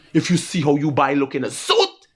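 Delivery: ordinary talk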